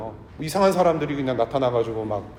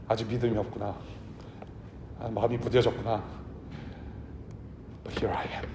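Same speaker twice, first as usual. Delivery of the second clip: Jesus gently tells disciples he missed them, hushed; tender loving Jesus; 4 semitones lower, 8 dB quieter than usual. Pretend. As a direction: speak like a teacher longing for his friends. Risen Jesus speaks lovingly.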